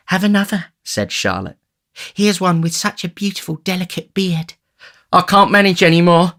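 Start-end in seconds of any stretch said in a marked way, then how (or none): 0.1-0.7 high feminine character voice; high-pitched doting young woman; coaxing sweetheart. A narrator's reading. Charlotte offers more oysters. 2.1-4.6 high, admiring feminine voice; high-pitched doting young woman; tempting sweetheart. A narrator's reading. Charlotte tempts Noah with oyster. 5.1-6.3 high, loud oafish complaint; high-pitched tipsy character; sated tipsy youth. A narrator's reading. Noah declines oysters.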